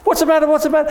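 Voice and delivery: high, loud, urgent questions; mother running downstairs calling in alarm. Acted. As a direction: call out in alarm while rushing down.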